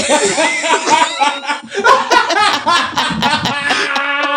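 long high-pitched laughing fit; real uncontrolled cackling; hard laughter. Real, not acted. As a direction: crack up helplessly. Cracking up at the singing.

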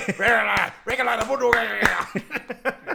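long, loud, high garbled holler; mock gibberish yelling. Farmer chasing a player, mock gibberish yelling. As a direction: imitate a wild incoherent chase yell.

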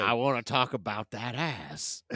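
low mock Texan-Russian accent; mock movie villain in Texan-Russian accent; Peter Stormare. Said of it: "Peter. Whatever you wanna say".

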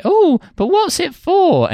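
put-on very high, slow, innocent girlish voice; naive Cinderella. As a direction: play dumb in a squeaky princess voice. Voicing Cinderella in the panto.

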